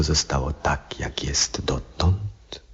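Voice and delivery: slow, fearful whisper; a frightened whisper of doubt. Acted. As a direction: draw it out under the breath. Doubting God's will.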